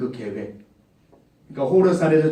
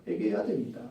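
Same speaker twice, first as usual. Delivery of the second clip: soft echo; 2 semitones higher, 10 dB quieter than usual. Real, not acted. Preacher urging young people, much softer echo.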